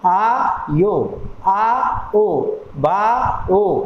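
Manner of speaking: slow, separate आ and ओ to expose the vowels inside बायो; vowels sounded out. Shown as a demonstration. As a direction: stretch each sound, बा ... ओ, with gaps. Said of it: "यो में भी ओ आ रहा है".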